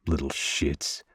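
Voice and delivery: low, soft grumble under the breath; muttering. A narrator's reading. Father watching his boys race off, muttering. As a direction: drop the voice and mutter it. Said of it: "I mutter".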